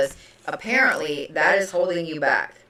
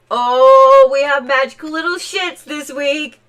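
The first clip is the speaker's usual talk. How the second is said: excited oh; 6 semitones higher, 8 dB louder than usual. Real, not acted. Excited tarot exclamation.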